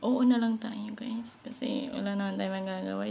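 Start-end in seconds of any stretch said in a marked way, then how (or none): 0.1-1.4 breathy whispered giggle through the words; laughing whisper. Real, not acted. To viewers about her strict boss, laughing whisper. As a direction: laugh it off under your breath.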